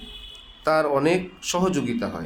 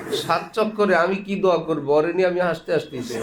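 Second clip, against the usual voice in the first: ordinary talk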